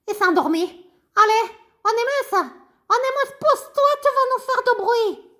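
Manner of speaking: high, bouncy little puppet voice; high childlike puppet greeting the class. Acted. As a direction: play the cheeky puppet pupil. Ratoun puppet talking.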